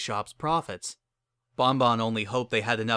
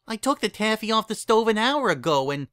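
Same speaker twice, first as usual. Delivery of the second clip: high-pitched candy maker voice; Bon Bon; 10 semitones higher, 4 dB louder than usual. A narrator's reading. Candy maker answers her young assistant, high character voice. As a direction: lift the voice high to answer a child.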